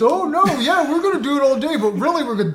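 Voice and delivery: high, loud, chirpy mock employee voice; mocking fast-food worker. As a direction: parody the fake-friendly McDonald's promise. Mocking McDonald's staff promise.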